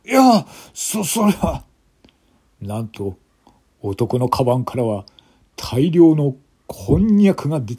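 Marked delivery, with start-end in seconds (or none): 0.1-1.6 flustered stammer. Acted. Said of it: "男は明らかに動揺している様子だ".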